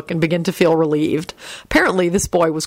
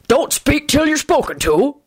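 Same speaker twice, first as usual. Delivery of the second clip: gruff warning in a higher-pitched character voice; Hagrid; 9 semitones higher than usual. A narrator's reading. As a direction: growl out an order. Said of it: "Hagrid growled".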